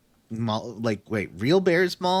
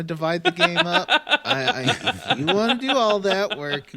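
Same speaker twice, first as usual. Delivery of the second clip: laughing; 5 semitones higher than usual. Real, not acted. Teasing co-host about notes.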